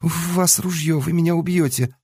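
put-on high, timid, trembling girl; frightened bleating. A narrator's reading. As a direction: squeak it out like a scared lamb. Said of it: "перестаньте блеять, как испуганная овечка".

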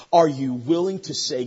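ordinary talk

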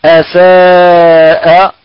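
أساء stretched long with a light, unthickened hamza; correct recitation. Shown as a demonstration. Teacher modelling the correct أساء for students, slow and light. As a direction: draw out أساء slowly and keep the hamza thin. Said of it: "فنقرأها بطبيعة هكذا".

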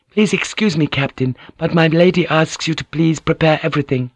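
high-pitched, courteous servant voice; lifted, deferential female voice; polite maid. Acted. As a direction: play a maid respectfully passing on a message. Maid addresses the captain.

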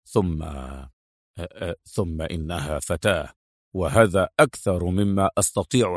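low, soft, hesitant protest; reluctant officer's low voice, halting; objecting subordinate. A narrator's reading. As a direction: object respectfully to the boss, deep and restrained. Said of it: "تجرأ أدهم وقاطع رئيسه قائلا".

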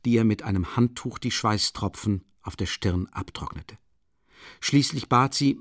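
ordinary delivery

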